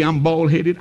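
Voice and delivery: ordinary delivery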